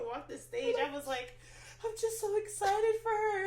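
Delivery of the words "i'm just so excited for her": high, loud outpouring; emotional gushing. Retold or imitated. Retelling pride at a graduation, overcome gushing. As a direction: gush as if overcome for her.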